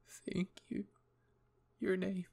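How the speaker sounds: high, faltering thanks through sobs; tearful, barely managed. Acted. Hanji hugging in tears, barely managed thanks. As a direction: squeeze the words out haltingly after crying. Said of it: "she managed to say".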